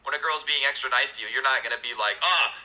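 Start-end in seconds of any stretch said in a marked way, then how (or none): 2.2-2.5 high, hammed-up alarm; mock-dramatic repulsion. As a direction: recoil theatrically as if from a monster. Mock recoil from a nice girl.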